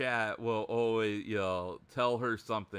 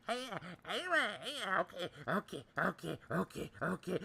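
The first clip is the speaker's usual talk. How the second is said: soft, high flustered stutter; mimicking a flustered streamer, softly; Miko; 5 semitones higher, 5 dB quieter, faster than usual. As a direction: stammer in a small put-on voice. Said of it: "she's like".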